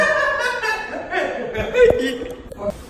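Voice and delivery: shrieking high laugh. Real, not acted. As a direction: burst into a squealing laugh. Laughing at friend's insult.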